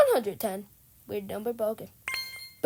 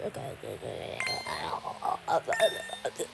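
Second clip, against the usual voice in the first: demon voice; 5 dB quieter, much faster than usual. Pretend. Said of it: "Did I sound like a demon?".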